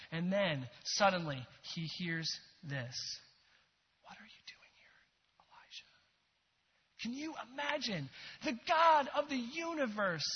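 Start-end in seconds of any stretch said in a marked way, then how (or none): 4.0-5.8 breathy whisper, barely audible. Shown as a demonstration. Preacher whispering God's question to Elijah. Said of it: "He whispered that phrase".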